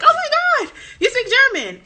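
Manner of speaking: high, loud, laughing squeal of amazement; delighted tourists. Retold or imitated. German tourists thrilled she speaks German, imitated delighted squeal. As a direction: gush like an astonished tourist, hammed up. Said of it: "they would always be like".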